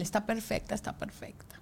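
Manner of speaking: susurrado, cada vez más bajo; repítelo en un susurro, conmovido; susurro emocionado. Retold or imitated. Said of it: "el marido llorando". (padre susurrando que la bebé está perfecta)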